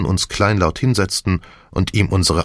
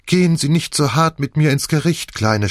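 mildly tearful plea, raised pitch; sniffling; 8 semitones higher than usual. A narrator's reading. Wife begs Nick for leniency, mildly sniffling. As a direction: appeal with a slight sniffle. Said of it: "schniefte seine Frau".

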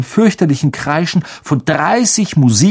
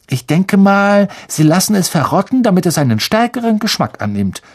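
höher, belehrend und besserwisserisch; altklug; 7 semitones higher than usual. Acted. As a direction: wie eine kleine Besserwisserin erklären. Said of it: "sagte Hermine altklug". (altklug belehrend)

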